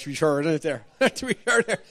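hammed-up Irish lilt, breaking into laughs; mock Irish accent. Pastor clowning for the church, laughing mock Irish accent. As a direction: push the stage Irish for a laugh. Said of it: "That's more Irish".

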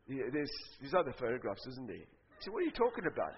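very soft, low and halting; hushed hesitant exchange. Acted. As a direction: ask it timidly under your breath. Sheepish questioning of Jesus.

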